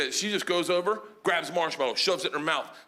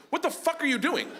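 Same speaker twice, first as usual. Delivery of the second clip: high-pitched indignant outburst; incredulous, pitched way up; alarmed trainer; 10 semitones higher than usual. Acted. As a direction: snap at him in sheer disbelief. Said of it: "And the trainer goes".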